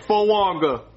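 low "Frog" as "fuh-wog-uh"; mispronounced frog. Acted. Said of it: "There's no W".

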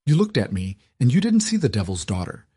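high, trembling furious outburst; fierce anger. A narrator's reading. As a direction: let the anger pour out at him. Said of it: "her voice trembling with sudden, fierce anger".

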